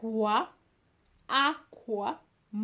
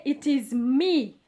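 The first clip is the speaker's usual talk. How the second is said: usual speaking voice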